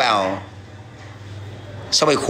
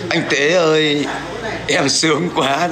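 mock moaning woman; slower than usual. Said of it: "mày chỉ bảo là".

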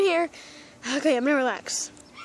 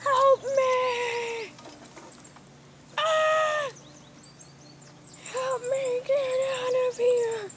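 higher, distant pleading wails; faraway cries for help; 7 semitones higher, 6 dB quieter, much slower than usual. Pretend. Pet being dragged under, distant high wails. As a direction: wail as if dragged far off. Pet pulled away, crying out.

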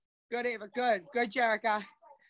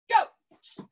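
sharp call. Real, not acted. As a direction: snap the class into motion. Instructor calling the start.

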